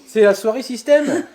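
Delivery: high, loud, laughing. Real, not acted. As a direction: say it while cracking up. Host laughing at the song.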